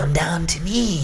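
hammed-up sinister summons. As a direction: play at a sinister villain luring someone in. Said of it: "sinister voice for that part".

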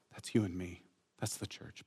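breathy aside to the listeners; whispered aside. Acted. Preacher whispers that the beloved is the church. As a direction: drop to a whisper.

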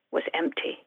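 hushed dramatic whisper. A narrator's reading. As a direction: whisper the reveal for suspense. Suspense reveal in story reading.

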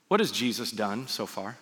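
ordinary talk

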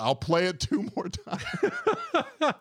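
very high, drawn-out mock plea breaking into laughter; plaintive. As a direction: give in pleadingly, imitating the proctor. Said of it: "like, plaintive".

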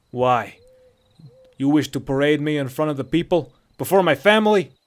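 loud, indignant Nord; husky Nordic outrage; husky Nordic captive. A narrator's reading. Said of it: "This voice was husky and distinctly Nordic".

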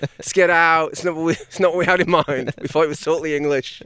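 high, loud, squeaky mosquito chatter; mosquito gang voice. Pretend. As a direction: play a fussy bug tasting blood. Mosquito voice continues.

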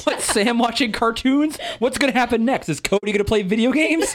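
high-pitched fake disbelief; mock alarm. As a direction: act scandalized by the utterly predictable. Joins in the mock surprise.

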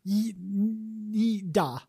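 put-on hesitant, halting Russian accent; mock Russian accent. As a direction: stumble into a fake Russian yes. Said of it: "the first Russian woman you could think of".

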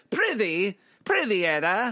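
high, loud Shakespearean voice; hammy stage actor. Acted. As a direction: overact it like a Shakespearean ham. Mock archaic stage banter.

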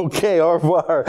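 clumsy anglophone French accent; laughing bad French accent. Real, not acted. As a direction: attempt the French, badly, with a laugh. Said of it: "my French accent is terrible".

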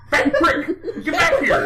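high-pitched call through laughter; laughing call. Retold or imitated. Anchorman gang calling Brick back from the other side, laughing. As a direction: call him back, cracking up.

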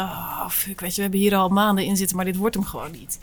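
hushed, defeated murmur; quiet inner thought. Retold or imitated. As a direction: mutter it to yourself in dismay. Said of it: "het moment dat jullie dachten". (zacht gemompelde gedachte)

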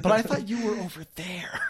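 puzzled, protesting improviser voice; mock confusion. Improviser questioning a partner about the scene, baffled. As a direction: object as if the scene stopped making sense.

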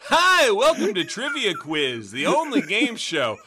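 loud, high, hammy host voice; game show host. Pretend. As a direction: announce it like a quiz show host.

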